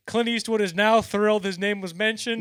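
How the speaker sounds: high, loud mock announcement; Don Rickles. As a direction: proclaim it like a roast comic. Retelling Rickles roasting Clint Eastwood.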